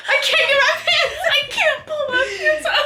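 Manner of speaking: put-on whiny drunk voice. Drunk friend stuck in bathroom.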